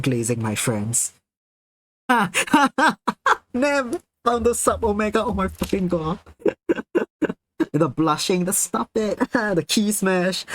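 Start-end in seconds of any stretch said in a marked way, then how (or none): 2.1-3.5 high, loud-peaking cackle; real burst of laughter. Real, not acted. Cracks up at friend's find. 3.5-6.2 high-pitched, through laughter; cracking up. Real, not acted. Cracking up at friend's find. 6.4-7.3 very high, wheezy giggles; helpless giggling. Real, not acted. Still cracking up. 7.7-10.5 higher, breaking into a laugh; amused, giggling. Real, not acted. Giggling over the chat's reaction.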